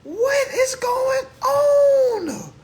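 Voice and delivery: high, stretched, wailing disbelief; shocked disbelief. Acted. Reacting to the rhetoric, high and stretched in disbelief. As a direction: cry out in mock alarm, very high.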